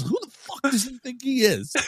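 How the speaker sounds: very high, indignant outburst; re-enacted incredulous fury; livid. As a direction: demand it in outraged high pitch.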